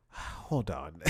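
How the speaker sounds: put-on low African-accented phone voice; mock African accent, dropped in pitch; imitated African accent. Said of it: "guy with an African accent".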